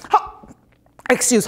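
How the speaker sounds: ordinary talk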